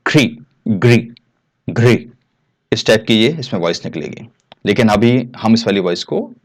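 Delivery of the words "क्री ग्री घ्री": क्री ग्री घ्री said slowly, with री blended in; pronunciation demo. Shown as a demonstration. Teacher shows the री sound with consonants, careful demo. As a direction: roll री into each consonant, slowly. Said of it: "का के साथ री की आवाज निकलेगी".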